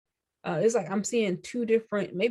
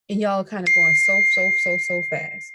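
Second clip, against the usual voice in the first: loud emphasis; 8 dB louder than usual. Acted.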